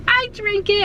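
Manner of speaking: squeaky high insisting "I drink it"; high grandma voice. Retold or imitated. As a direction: pitch way up like grandma.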